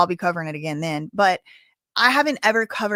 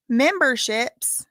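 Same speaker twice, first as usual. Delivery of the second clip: ordinary talk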